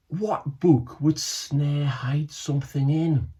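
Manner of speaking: slow, pondering question; wondering. Acted. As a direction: think it through slowly. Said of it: "wondered Shenoy".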